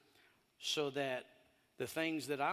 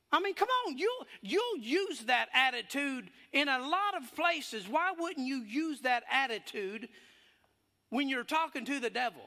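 loud, high, fervent preaching voice; full-force preaching; 12 semitones higher, 7 dB louder than usual. Acted. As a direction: preach it to the whole church, full voice. Preaching to congregation.

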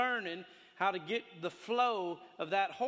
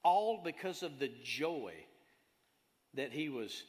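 usual speaking voice

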